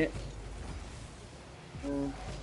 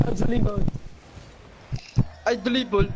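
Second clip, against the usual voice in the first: I dribble, louder and higher; hyped boast; 4 semitones higher, 4 dB louder than usual. Shown as a demonstration. Bragging about dribbling to teammates, hyped boast.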